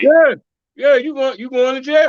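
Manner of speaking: high, loud gleeful jailer taunt; mock cop. Co-host playing a cop arresting a taunter, loud and high. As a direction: bark it up high like a smug cop.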